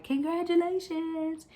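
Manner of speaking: high-pitched, drawn-out congratulations; mock congratulations. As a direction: gush it high and slow, imitating the girls. Retells girls congratulating her.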